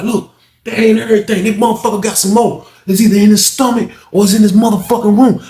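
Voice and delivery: insistent. Retold or imitated. Unc insisting.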